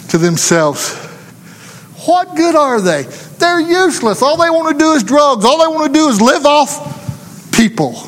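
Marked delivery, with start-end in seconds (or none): 2.1-8.0 high, mocking voice of contempt; scornful society. The preacher voices how society looks down on the homeless, high and mocking. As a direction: sneer it in a high-pitched mocking tone.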